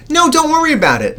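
high, loud put-on reassuring voice; hammed-up "no worries" from someone else; imitated reassurance. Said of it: "trying to get the person to then say".

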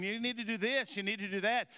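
imitating his wife nagging him while he works. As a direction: mimic the wife's little bossy orders. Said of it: "this little voice over here going".

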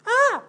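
high, loud imitation of a toddler's scream. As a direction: mimic a defiant child's shriek. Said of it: "stop screaming".

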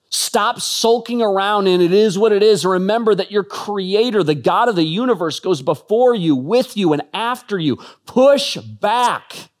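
rally the church to push back; fiery preaching. Acted. Preacher exhorting followers to push back, fiery preaching.

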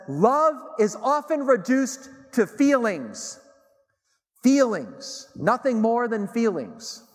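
mock-dramatic. Quoting, then mocking song line.

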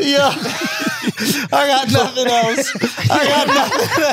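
high, helpless laugh through the words; laughing hard. Real, not acted. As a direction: barely get it out through laughter. Cracking up after own joke.

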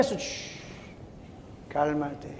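long soft shush, then low calm word; hushed, low and unruffled; gentle hushing. Retold or imitated. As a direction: soothe him down softly. God hushing him.

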